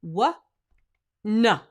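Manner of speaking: loud "Wha, na" with hard onsets; firm wha na. Shown as a demonstration. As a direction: hit "Wha" and "na" firmly. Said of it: "If you go".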